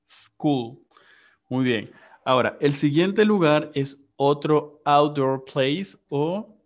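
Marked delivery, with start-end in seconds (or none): 0.1-0.8 school starting on a clean, sustained S; correct initial S. Shown as a demonstration. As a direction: say school with no E before the "sk". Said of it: "Se pronuncia s".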